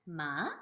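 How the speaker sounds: "ma" starting low and rising; second tone. Shown as a demonstration. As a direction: ask "ma" as a rising question. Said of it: "that's the going up sound".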